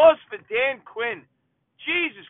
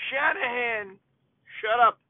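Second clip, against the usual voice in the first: normal talking voice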